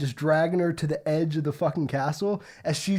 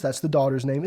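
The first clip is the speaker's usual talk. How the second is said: usual speaking voice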